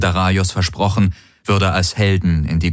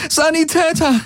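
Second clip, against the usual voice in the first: very high, urgent cry for help; calling out. A narrator's reading. Woman beside a wounded comrade, calling out for a medic. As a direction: call desperately for a medic. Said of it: "rief sie den wenigen Golvonen zu".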